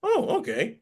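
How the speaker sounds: high, delighted whoa of surprise; mock amazement, voice jumping way up; amazed. Podcaster recalls first watching the episode, amazed. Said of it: "I'm like going".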